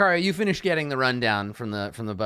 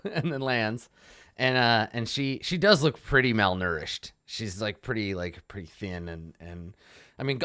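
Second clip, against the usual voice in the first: usual speaking voice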